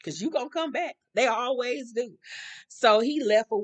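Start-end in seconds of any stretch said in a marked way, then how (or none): 0.0-2.2 high, airy send-off; mock unbothered. Gloria sees off her departing lover, unbothered. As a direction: wave him off in a high, unworried voice. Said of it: "Gloria wasn't even sweating".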